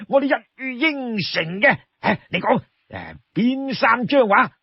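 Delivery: high, eager string of promises; overjoyed Li Kui. Acted. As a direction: agree to anything, bubbling over.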